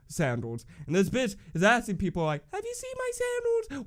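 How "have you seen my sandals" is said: high, thin mocking voice of the barefoot girl; mock high whiny voice. Girl at the concert asking everyone for her sandals, mock high squeak. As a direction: squeak it like a lost, helpless girl.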